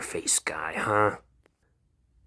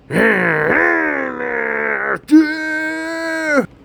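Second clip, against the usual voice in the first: maniacal screaming laugh; 9 dB louder than usual. Acted.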